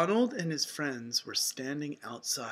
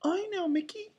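very high, light falsetto; Minnie; 14 semitones higher than usual. A narrator's reading. Mouse offering her friend the answer, high falsetto. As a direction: answer brightly as a sweet cartoon mouse. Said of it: "answered Minnie".

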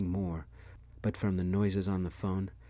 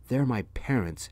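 mild narrated yell, louder and higher; 4 semitones higher, 6 dB louder than usual. A narrator's reading. Husband defending his parents to his wife, moderate yell. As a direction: raise the voice in defensive protest. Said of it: "Dad yelled".

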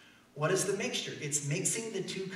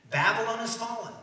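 loud, raised preacher's proclamation; forceful declaration; 6 semitones higher, 6 dB louder than usual. Acted. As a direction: declare it to the whole congregation. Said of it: "That's what we're declaring".

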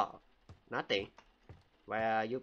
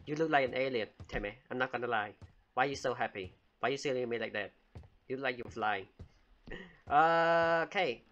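ordinary delivery

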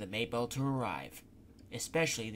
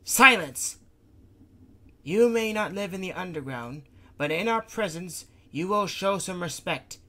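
loud, higher commanding rebuke; stern scolding; 7 semitones higher, 8 dB louder than usual. Acted. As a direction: slam down order, then firm.

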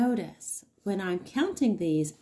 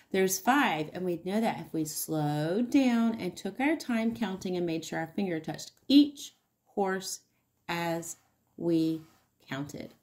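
normal talking voice

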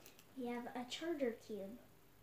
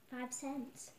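normal talking voice

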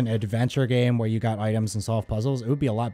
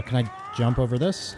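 normal talking voice

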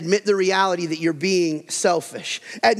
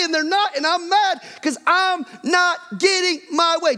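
high-pitched hammed-up complaining; mock frustrated rant; 9 semitones higher than usual. Preacher playing a frustrated parent ranting to a spouse. Acted angry spouse confessions.

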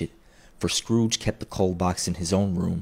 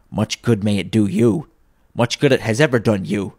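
loud, emphatic sneer; scornful; 2 semitones higher, 7 dB louder than usual. A narrator's reading. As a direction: pour contempt on the nephew. Scrooge sneers at nephew's Christmas.

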